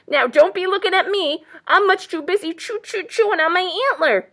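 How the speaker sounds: high, loud, stuttering busy porcupine; sputtering porcupine. A narrator's reading. Porcupine refuses while chewing antler, sputtering. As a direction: sputter on the CHEW. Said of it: "sputtered the porcupine".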